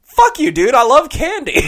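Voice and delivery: high, loud cowboy, laughing through it; bratty cowboy yelling back; defiant cowboy. Acted. The cowboy tells off the bartender, high, loud and laughing. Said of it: "The cowboy goes".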